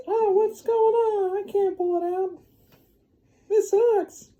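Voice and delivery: voce altissima e lamentosa, molto più acuta, da signora esasperata; high whiny lady. Acted. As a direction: squeal it out like a frustrated lady. Lady whines can't pull sword.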